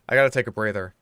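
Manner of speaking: ordinary delivery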